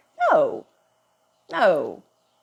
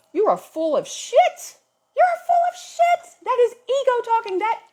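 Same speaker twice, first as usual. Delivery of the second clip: mock outraged yell; 4 dB louder than usual. Calling out a smug spiritual person, mock outraged yell. Mocking outburst at spiritual people.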